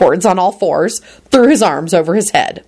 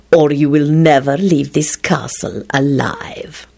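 slow whispered death threat; deadly snarl; 4 semitones lower, slower than usual. A narrator's reading. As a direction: draw the threat out, low and whispered. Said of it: "he snarled in a deadly tone".

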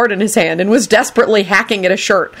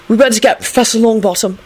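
quickly; 4 semitones higher than usual. Acted. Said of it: "Alex said quickly".

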